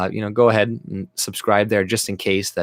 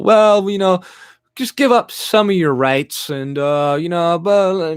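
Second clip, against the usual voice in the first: much higher, louder simpering voice; mocking compromiser; 8 semitones higher, 5 dB louder, slower than usual. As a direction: mimic someone urging you to give up rights. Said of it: "Don't listen to these people".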